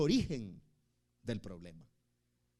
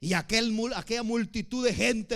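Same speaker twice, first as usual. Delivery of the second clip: ordinary talk, nothing marked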